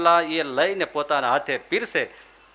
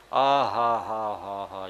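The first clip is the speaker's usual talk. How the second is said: slow savoring ahh; 11 semitones lower, 6 dB quieter, much slower than usual. Retold or imitated. Relishing, drawn out slowly.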